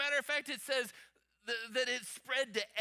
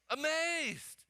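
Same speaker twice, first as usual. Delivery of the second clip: higher, drawn-out emphatic call; said out loud; 8 semitones higher than usual. Shown as a demonstration. Preacher prompting congregation to say the word, out loud. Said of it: "Say it out loud".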